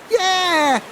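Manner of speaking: high gleeful shout; delighted scream. Acted. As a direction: squeal with delight. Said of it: "screamed Phyllis in delight".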